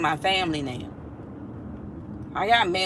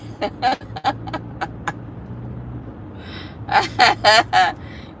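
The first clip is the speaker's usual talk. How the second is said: long laugh; 3 semitones lower than usual. Real, not acted. Laughs at the video.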